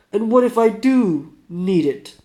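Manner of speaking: put-on challenging Russian accent, high, loud and slow. Sick man defending his need for a cigarette, challenging Russian accent. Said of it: "do my best, uh, Russian".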